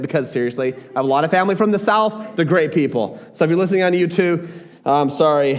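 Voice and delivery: ordinary delivery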